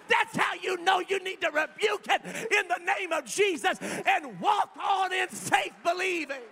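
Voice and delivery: loud, high, building preacher's cry; full-force preaching. Real, not acted. Pastor to the congregation, preaching at full force.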